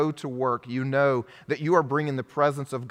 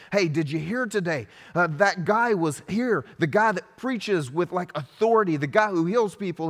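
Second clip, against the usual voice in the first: higher, eager small-town gossip bursting with news; excited gossiping townsperson; 6 semitones higher, 3 dB louder than usual. Pretend. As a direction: rattle off the rumour like a neighbour who can't wait to tell. Imagined townsperson spreading gossip.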